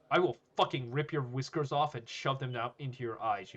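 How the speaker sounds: loud, emphatic tirade; angry threat. Acted. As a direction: pour out the threat forcefully. Furious threat at the bully.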